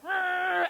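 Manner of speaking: high, loud imitated yelp; yelp of alarm. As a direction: mimic him crying out as he slides off. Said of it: "I saw him like".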